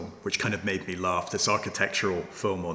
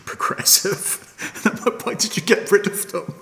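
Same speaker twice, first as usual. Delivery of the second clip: laughing; 11 semitones higher, 4 dB louder than usual. Real, not acted. Laughing disbelief at scrapped trams.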